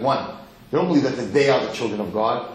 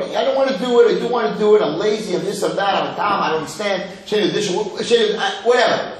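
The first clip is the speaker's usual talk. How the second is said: higher, lightly acted protest; mock reluctant excuses; 6 semitones higher, 3 dB louder than usual. Teacher imitating the son who refuses the Seder, mock reluctance.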